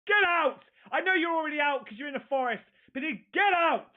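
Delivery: loud, high frightened yelling; shouting. Real, not acted. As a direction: yell at the thing in the trees. Reactor yells at the creature on screen.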